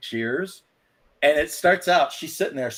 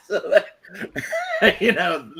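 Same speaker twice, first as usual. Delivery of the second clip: still laughing; 7 semitones higher than usual. Real, not acted.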